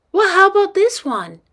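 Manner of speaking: put-on high, perky kid voice; eager little girl. Girl picks another box to push, eager high voice. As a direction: pitch it up like a keen child.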